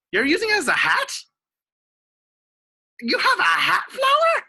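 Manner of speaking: loud, high-pitched disbelief; amazed shout. Real, not acted. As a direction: squeal the question in loud astonishment. Amazed at a child's flower hat.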